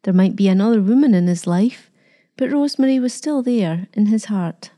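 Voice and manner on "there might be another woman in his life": ordinary delivery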